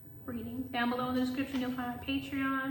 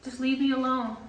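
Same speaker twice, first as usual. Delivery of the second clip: ordinary delivery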